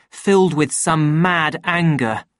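ordinary delivery

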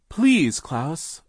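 high, loud plea; crying out. A narrator's reading. Sister begs her brother, crying out. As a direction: cry out, begging him. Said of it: "Violet cried".

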